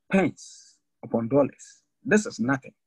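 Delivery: ordinary talk, nothing marked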